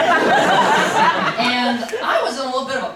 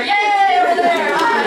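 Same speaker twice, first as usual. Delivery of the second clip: louder, higher cheer; cheering a friend in the crowd; shout out; 5 semitones higher, 6 dB louder than usual. Real, not acted. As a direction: call out across the room. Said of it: "shout out to".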